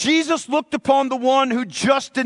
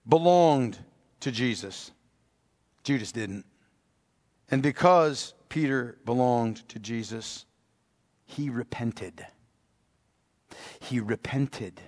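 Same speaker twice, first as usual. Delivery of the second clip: hushed preaching; 11 semitones lower, 7 dB quieter than usual. Acted. Hushed, intimate sermon passage.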